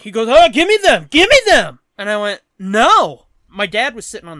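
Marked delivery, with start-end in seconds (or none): 0.3-1.8 high, loud grunted demand; mock demanding shout. Junker demanding cans from the storyteller, mock shouting. 2.6-3.3 loud, firm refusal; retold loud refusal. Storyteller refusing the junker his cans, loud retold refusal.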